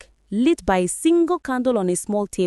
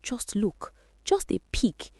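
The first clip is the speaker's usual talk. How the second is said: soft, much quieter inner voice luring her; tempting whisper; 5 dB quieter than usual. A narrator's reading. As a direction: murmur it like a temptation inside her head. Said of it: "Something inside her whispered".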